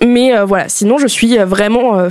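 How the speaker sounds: ordinary delivery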